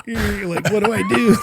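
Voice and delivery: mock helpless, laughing; panicked laugh. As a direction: engineer panicking at the board, with a laugh. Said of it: "I'm just like".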